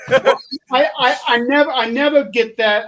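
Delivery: laughing, high and loud. Real, not acted. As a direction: laugh through the words. Laughing at latitude joke.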